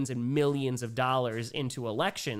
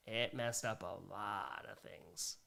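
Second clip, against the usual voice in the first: low, slow and hushed on "It messed up a lot of things"; slow, sobered agreement, pitched down; grave echo; 6 semitones lower, 10 dB quieter, slower than usual. Real, not acted. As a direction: repeat it softly to yourself, drawn out. Echoes the comment gravely.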